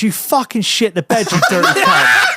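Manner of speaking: put-on half-laughing as the girlfriend teasing; laughing mock insult. Holly laughing at him in bed.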